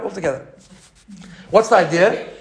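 ordinary delivery

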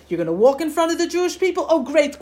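high, quick, eager delight; Moshe Rabbeinu. Acted. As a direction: pile on excitedly, like the asking child. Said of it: "Moshe Rabbeinu says".

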